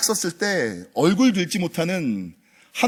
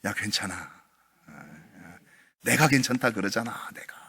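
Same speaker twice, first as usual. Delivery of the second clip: much softer, lower reassurance; tender comforting voice of God; God's comfort; 11 semitones lower, 10 dB quieter than usual. Retold or imitated. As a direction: gently reassure a guilty child. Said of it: "주님께서 말씀하십니다".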